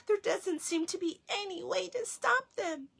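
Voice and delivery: put-on a boy who can't stop the music; young boy voice.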